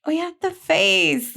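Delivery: tearful. Real, not acted. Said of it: "That's emotional".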